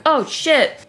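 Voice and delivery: high-pitched alarmed exclamation; mock panic. Cryptic after attacking Spurlo, mock panicked. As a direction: blurt it high, as if about to flee.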